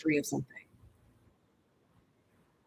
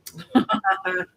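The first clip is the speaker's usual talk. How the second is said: laugh; 13 semitones higher than usual. Real, not acted. Laughs at two-hour session.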